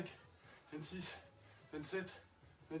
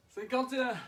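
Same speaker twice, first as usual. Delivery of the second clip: triumphant shout; 7 semitones higher, 8 dB louder than usual. Real, not acted. Counting last squat, finishing set.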